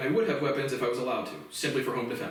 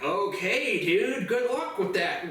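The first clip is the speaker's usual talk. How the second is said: slow, higher dismissive drawl; dismissive operator; 5 semitones higher, much slower than usual. Retold or imitated. FBI operator brushing off his call, slow dismissive voice. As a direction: brush him off like a bored operator. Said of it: "he's like".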